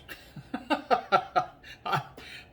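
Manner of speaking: long, high-pitched burst of real laughter; laughing. Real, not acted. Vlogger laughing at being pooped, real laughter. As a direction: laugh helplessly at yourself.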